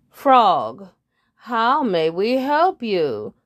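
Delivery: slow, loud prim bird; drawn-out polite question; head bird. Acted. Head bird asking the shy frog, slow and loud.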